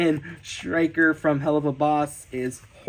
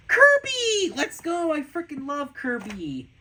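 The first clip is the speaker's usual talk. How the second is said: high-pitched fan squeal, easing off; thrilled, much higher voice settling down; excited squeal; 8 semitones higher than usual. Real, not acted. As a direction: gasp and squeal with delight. Fan thrilled at favourite character drawing.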